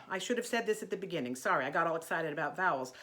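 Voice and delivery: normal talking voice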